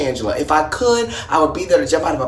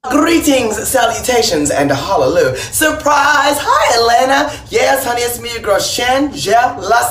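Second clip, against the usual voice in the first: big drag greeting; 3 semitones higher than usual. Acted. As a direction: belt it out like a diva on stage. Drag queen birthday video greeting.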